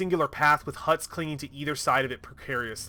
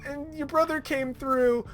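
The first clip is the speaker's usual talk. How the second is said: mock pleading; 10 semitones higher than usual. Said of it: "begged and pleaded and cried".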